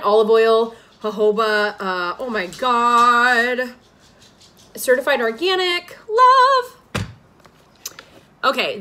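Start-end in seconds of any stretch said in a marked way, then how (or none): 1.8-3.7 slow drawn out oh my God; slow amazed oh my God; delighted. Real, not acted. Delight at product. 6.1-7.0 high loud squealed love; high delighted love; excited squeal. Real, not acted.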